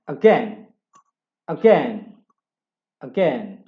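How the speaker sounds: again said loudly as agen; loud drill. Shown as a demonstration. Teacher drilling again for the class, very loud. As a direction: hammer again, agen, with a short e. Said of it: "Hurufnya A I dibaca E".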